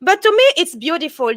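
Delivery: ordinary talk, nothing marked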